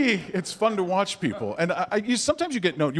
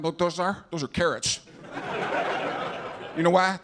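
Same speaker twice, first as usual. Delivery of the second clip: simple-minded TV painter impression; George W. Bush; 4 semitones lower than usual. Former president hosting a painting show, simple-minded impression. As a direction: explain the silly picture with easy self-assurance. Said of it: "I wanna see a painting show".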